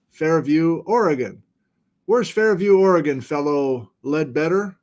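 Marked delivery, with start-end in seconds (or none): none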